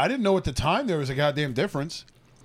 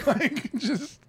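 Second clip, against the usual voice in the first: normal talking voice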